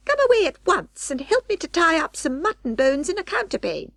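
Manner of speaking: high squeaky orders, in a hurry; Anna Maria voice. Acted. Rat wife bossing her husband to pack up, high and bossy.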